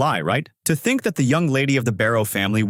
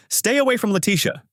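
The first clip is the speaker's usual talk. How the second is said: calling out; 11 semitones higher than usual. A narrator's reading. Alban calls out to shield Letitia from the bear, high protective cry. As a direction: call out to warn him off. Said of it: "He calls out".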